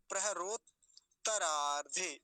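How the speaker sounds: प्रहरोत्तरार्धे slowly, split after प्रहरोत्; प्रहरोत्तरार्धे drawn out in two careful parts; both त sounded. Shown as a demonstration. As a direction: hold the double त in प्रहरोत्तरार्धे. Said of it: "दोनों का उच्चारण हो जाना चाहिए".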